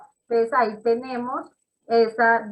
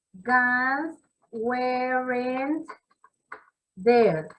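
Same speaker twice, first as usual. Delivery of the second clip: slow dictation; much slower than usual. Shown as a demonstration. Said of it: "teacher spells out example slowly".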